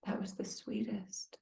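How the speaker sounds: tender whispered wonder. Retold or imitated. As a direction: breathe it in awe. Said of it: "we go".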